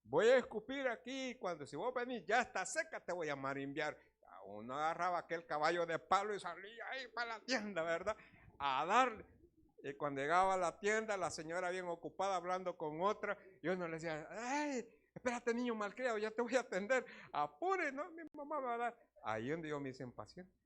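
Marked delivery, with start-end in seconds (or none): 0.0-4.0 louder, higher mock threat from mom; scolding mother. Mother sending her son to the shop, hammed-up scolding threat. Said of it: "mi mamá era de aquellas que decía". 14.3-19.0 much higher, shrill-free mock lady voice scolding; annoyed shopkeeper. Shop lady chatting with a friend, mock high scolding of the boy.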